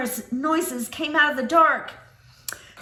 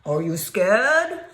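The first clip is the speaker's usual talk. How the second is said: monster voice; 6 semitones lower, 4 dB louder than usual. Acted. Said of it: "The creature asked".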